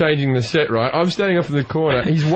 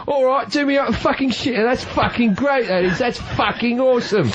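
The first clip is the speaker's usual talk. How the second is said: put-on higher, brash northern British accent; British slapper accent; 8 semitones higher than usual. Mocking British porn talk for American hosts, higher British accent. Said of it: "their accents are so weird".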